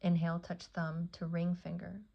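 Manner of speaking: ordinary delivery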